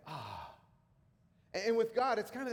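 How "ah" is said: soft, low satisfied "ah"; quiet sigh of satisfaction; contented sigh. Retold or imitated. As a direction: sigh it out, content with the job done. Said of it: "it's that feeling of like".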